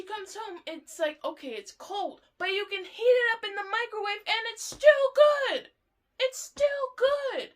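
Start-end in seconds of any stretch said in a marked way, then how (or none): 2.4-7.5 rising, louder insistence on it still being good; insistent. Real, not acted. To Bob, defending cold fries, loud and insistent. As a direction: push hard that it's still good.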